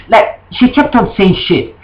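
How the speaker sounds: ordinary delivery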